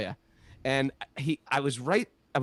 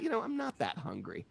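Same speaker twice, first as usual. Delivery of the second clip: much softer and higher, a shy inner aside; sheepish thought; 8 semitones higher, 10 dB quieter than usual. Retold or imitated. A pilgrim caught buying a giant sweet thinks to himself, sheepish and soft. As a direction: hide your embarrassment.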